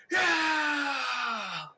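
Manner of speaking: high, loud cheering yell; crowd shout. Acted. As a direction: roar it like a crowd. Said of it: "And they shout".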